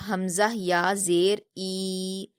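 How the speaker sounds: ای stretched over two beats, slow and even; drawn-out recitation. Shown as a demonstration. Teacher drilling a child, recitation stretching ای. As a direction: hold the long ای for two counts. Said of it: "دو حرکات کھینچنا ہے اس کو".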